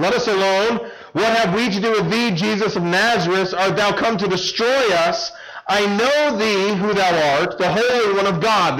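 a cornered demon crying out at Jesus. Acted. Unclean spirit in the synagogue crying out to Jesus, demon cry. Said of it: "he cried out".

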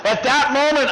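usual speaking voice

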